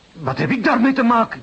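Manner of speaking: high, loud, outraged protest; indignant outburst. Acted. Banker protests his innocence to the inspector, indignant and loud. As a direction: flare up at the inspector's accusation.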